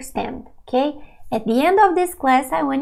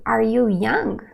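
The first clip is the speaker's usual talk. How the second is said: ordinary talk, nothing marked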